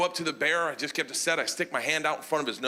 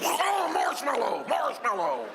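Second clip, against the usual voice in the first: high, slow, drawn-out cry; helpless panicked wail; 7 semitones higher, slower than usual. Retold or imitated. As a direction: wail the safe word in mock despair. Helpless cry while bear grinds.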